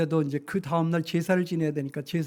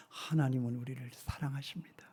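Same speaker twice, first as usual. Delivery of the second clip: hushed whisper; 3 semitones lower, 9 dB quieter than usual. Real, not acted. Preacher telling the congregation God loves them, soft whisper. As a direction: whisper it, much softer.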